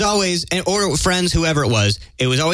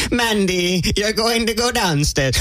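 a higher, slowed grand announcement; higher, slower mock proclamation to Mandy; mock wizard proclamation; 6 semitones higher, slower than usual. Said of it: "You have to sing that to her".

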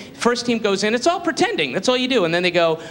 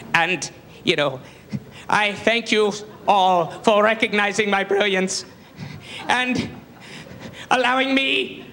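James Lipton impression; 5 semitones higher than usual. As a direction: savour every grand word of the speech. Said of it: "he's going".

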